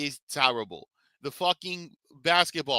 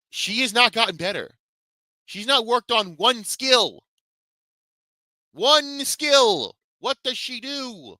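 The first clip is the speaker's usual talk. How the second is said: much higher, building to a shout; heated rant; 11 semitones higher than usual. Real, not acted. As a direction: rant hard, hammer each point. Heated rant about a player.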